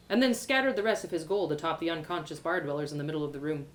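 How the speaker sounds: usual speaking voice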